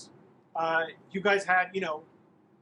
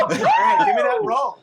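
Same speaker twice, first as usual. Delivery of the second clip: very high, loud, thrilled yell; excited shout; 12 dB louder than usual. Real, not acted. Game master demanding the roll for Puppet, excited shout. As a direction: shout it over the uproar, really elated.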